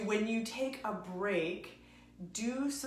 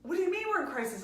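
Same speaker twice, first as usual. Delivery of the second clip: much higher, fast worried question; retold alarm; 10 semitones higher, 4 dB louder, much faster than usual. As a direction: imitate a startled ex. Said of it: "And he goes".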